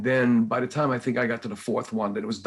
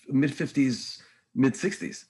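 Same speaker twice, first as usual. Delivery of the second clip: usual speaking voice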